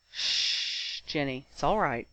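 low, soft hush; soothing. A narrator's reading. Ron holding crying Ginny's hand, soothing. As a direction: gently shush and comfort.

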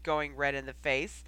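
ordinary delivery, nothing marked

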